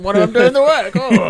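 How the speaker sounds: high, loud put-on grumbler; Trev. Co-host mocking idle road workers to friends, hammed-up impression. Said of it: "is that Trev?".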